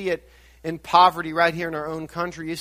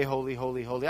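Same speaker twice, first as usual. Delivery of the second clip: softer, hushed holy, holy, holy; quiet praise; 5 semitones lower, 8 dB quieter, faster than usual. Pretend. Preacher worships by faith, saying it softly. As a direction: say it low, like a private prayer. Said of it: "and I can say".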